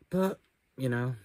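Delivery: usual speaking voice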